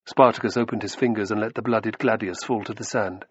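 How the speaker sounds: ordinary delivery, nothing marked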